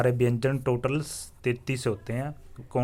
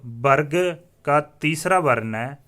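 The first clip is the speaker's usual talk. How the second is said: 1 slow teaching emphasis; 3 semitones higher, 6 dB louder, slower than usual. Shown as a demonstration. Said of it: "देखिए".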